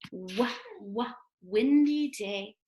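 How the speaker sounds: "Wah, wah" then "windy" with the W leaned on; stressed W sound. Shown as a demonstration. Teacher pointing out the W sound to kids, leaning on "windy". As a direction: hit the W in "windy". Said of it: "there's that letter W".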